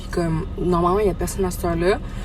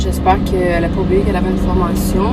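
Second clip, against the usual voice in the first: mouth full. Real, not acted. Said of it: "Je parle avec la bouche pleine".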